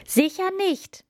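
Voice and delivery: very high, sudden outburst; blurted refusal. A narrator's reading. Princess refusing to carry the frog upstairs, blurted refusal. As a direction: let the refusal burst out, pitched right up. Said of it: "entfuhr es der Prinzessin".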